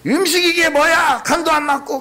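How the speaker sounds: high, snappish mock grumble at the restaurant food; irritated complaint. Newly rich man complaining about food at restaurant, high irritated mock grumble. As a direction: play the newly rich man griping at the waitress. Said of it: "막 이러면서 막 짜증을 낸다고".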